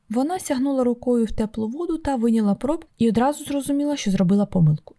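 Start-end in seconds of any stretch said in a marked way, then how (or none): none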